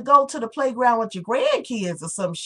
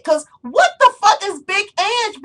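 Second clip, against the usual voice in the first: higher, louder exasperated question; loud rant; 10 semitones higher, 5 dB louder than usual. Real, not acted. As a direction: throw it out loud in disbelief. Ranting at Mob Wives.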